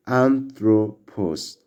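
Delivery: anthropos drawn out slowly, every syllable AN-thro-pos clear; slow Greek pronunciation. Shown as a demonstration. Teacher slowly modelling Greek word for class. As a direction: spell out anthropos slowly for learners. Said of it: "This word is pronounced like".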